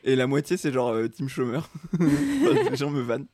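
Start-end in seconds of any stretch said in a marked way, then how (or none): 1.9-3.3 slow, laughing through words. Real, not acted. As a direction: laugh while you say it.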